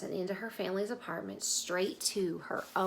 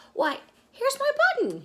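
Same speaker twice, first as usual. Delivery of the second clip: much higher, louder glad cry; excited cry; 7 dB louder than usual. Acted. The bear finding his button, crying out higher and louder. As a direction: call it out in delight. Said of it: "he cried".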